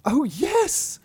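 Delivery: princess voice. Acted. Princess answering the frog, high girlish squeal.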